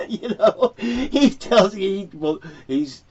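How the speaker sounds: high, breaking into real laughter; laughing while talking. Real, not acted. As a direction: tell it through a burst of laughter. Laughs at the pro shop answer.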